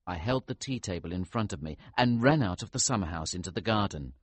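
ordinary delivery, nothing marked

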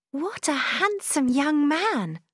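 high, slow, thrilled exclamation; young sister delighted by the handsome visitor; excited cry. A narrator's reading. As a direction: gush over him like a smitten girl. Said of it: "Margaret cried".

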